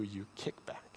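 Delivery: dropped to a sudden whisper. Retold or imitated. God rebuking Paul, dropping to a whisper. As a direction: breathe the last words almost unvoiced. God's voice quoted, sudden drop.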